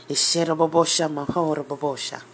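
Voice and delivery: fast, rhythmic tongues; speaking in tongues. Real, not acted. Preacher bursting into tongues mid-sermon, rapid and rhythmic. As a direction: let the tongues flow.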